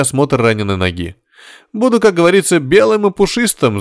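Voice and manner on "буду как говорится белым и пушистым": very high, jovial promise; playful amusement. A narrator's reading. Patient promises the nurse to behave, playfully amused. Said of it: "засмеялся Горишвили".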